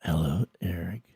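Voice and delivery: low, breathy creepy whisper. Acted. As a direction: whisper it right in his ear. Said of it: "Elliot whispering".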